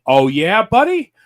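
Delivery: mocking "buddy?" that shoots up high and loud; heckling a wrestler, mock challenge; mocking taunt. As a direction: taunt him like a heckler at ringside. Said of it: "And I'm like".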